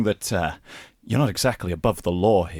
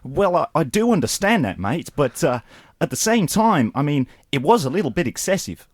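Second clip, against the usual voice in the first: put-on light, higher Australian accent; lightly done Australian accent; Australian soap accent; 6 semitones higher than usual. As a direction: ham up an Aussie soap bloke. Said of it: "Australian soap".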